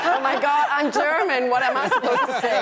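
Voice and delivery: bursting out high and loud through laughter; laughing exclaim. Real, not acted. German panelist laughing about accents, loud laughing outcry. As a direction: laugh it out, mock-panicked about her own accent.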